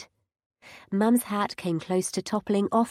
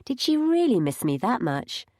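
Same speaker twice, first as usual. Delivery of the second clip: usual speaking voice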